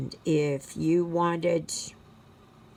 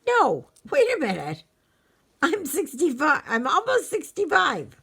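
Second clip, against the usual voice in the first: startled realization; 10 semitones higher, 5 dB louder than usual. Retold or imitated. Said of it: "and then I have to think".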